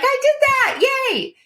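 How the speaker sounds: put-on very high, squeaky child's cheer; imitating a son's joyful cheer; proud happy kid. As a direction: squeal it like a thrilled little boy. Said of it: "He's super happy".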